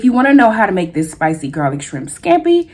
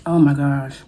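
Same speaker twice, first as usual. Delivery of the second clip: ordinary talk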